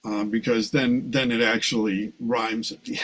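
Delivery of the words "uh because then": ordinary delivery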